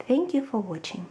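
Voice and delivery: plain reading voice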